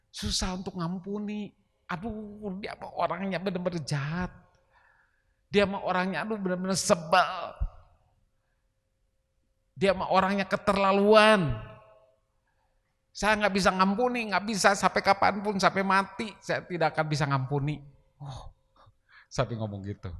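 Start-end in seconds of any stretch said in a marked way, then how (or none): 0.1-11.7 mock exasperated grumbling, higher; kesal, menggerutu. Acting out a grudging believer. 13.2-17.8 stubborn mock vow, higher; menolak mengampuni. Acting out refusing to forgive.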